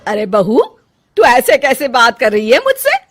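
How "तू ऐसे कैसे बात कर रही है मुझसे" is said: loud, shocked rebuke rising at the end; mother-in-law taking offence; indignant, high-rising challenge; affronted. Acted.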